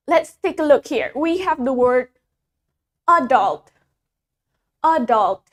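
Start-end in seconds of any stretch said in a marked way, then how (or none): 3.1-5.5 ADULT stressed on the first syllable, AD-ult; British stress on first syllable. Shown as a demonstration. Teacher demonstrating British "adult" to students, stress on first syllable. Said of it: "The stress is in the front".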